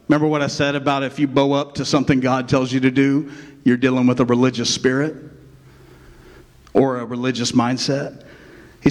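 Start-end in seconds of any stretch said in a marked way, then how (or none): none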